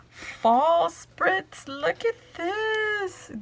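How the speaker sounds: squealing excitement. Real, not acted. Unboxing a friend's gift to her viewers, squealing. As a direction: gush like you can't contain it. Showing fall spritz pieces.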